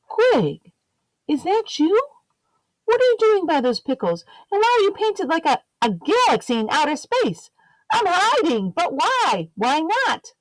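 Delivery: two little eggs chattering in the aisle, chirpy and quick; squeaky egg voices. Acted. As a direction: bounce between the two eggs cheerfully.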